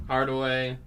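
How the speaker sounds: ordinary delivery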